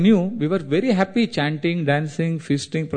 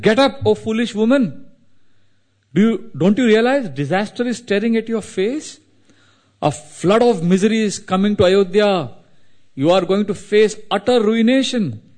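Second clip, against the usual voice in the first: raised, urgent higher-pitched warning; alarmed scolding; 6 semitones higher than usual. A narrator's reading. Manthara rousing foolish Kaikeyi to coming disaster, alarmed scolding. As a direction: scold her as if disaster is at the door.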